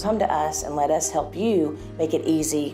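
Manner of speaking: normal talking voice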